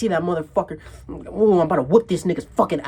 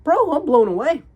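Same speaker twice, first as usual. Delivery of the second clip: amazed; 8 semitones higher, 5 dB louder than usual. Retold or imitated. Retelling his amazement.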